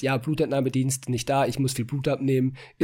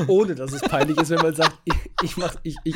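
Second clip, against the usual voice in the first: embarrassed laugh, a bit louder; 4 semitones higher, 4 dB louder than usual. Real, not acted. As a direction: laugh it out sheepishly.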